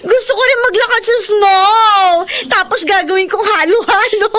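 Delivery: loud, high squeal of delight; thrilled. Acted. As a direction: gush over the snow like a giddy kid. Diday thrilled seeing snow.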